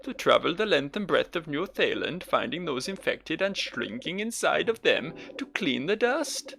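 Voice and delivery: put-on grand speech in a failed Welsh accent; Welsh accent attempt. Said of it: "going for Welsh".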